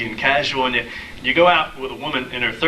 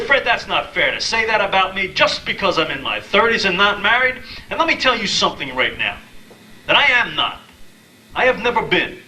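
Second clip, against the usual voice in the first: indignant protest; 6 semitones higher than usual. Retold or imitated. Indignant reply to Fred.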